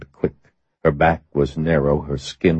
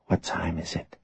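hushed question, much softer; whisper; 12 dB quieter than usual. A narrator's reading. Woman waking her bedmate to ask the time, whispered. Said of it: "She asked him in a whisper".

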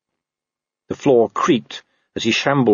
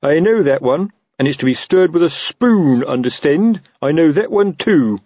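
higher, indignant orders; blustering boss, moderately raised; shouting captain; 7 semitones higher, 3 dB louder than usual. Acted. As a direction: call out suspicious warnings. Colon keeps shouting from doorway.

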